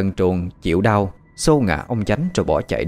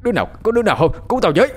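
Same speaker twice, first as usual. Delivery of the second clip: high, panicked cry for help; terrified scream; 9 semitones higher, much faster than usual. A narrator's reading. Frightened master screaming for his servants, terrified. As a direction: shout in fear, voice leaping high. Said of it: "Ông Chánh sợ quá, hét lên".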